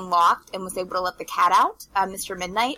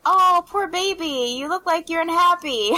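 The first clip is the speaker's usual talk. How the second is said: put-on cooing over a baby; 8 semitones higher than usual. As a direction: imitate someone fussing sweetly over a poor baby. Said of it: "she was just like".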